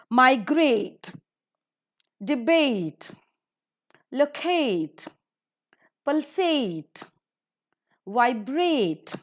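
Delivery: Migrate, debate, locate, pulsate, vibrate, each stressed on the last syllable; mi-GRATE, de-BATE, lo-CATE, pul-SATE, vi-BRATE, word by word; stress on second syllable. Shown as a demonstration. Teacher models "-ate" words, Migrate to vibrate with final syllable stressed. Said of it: "given the stress in the second syllable".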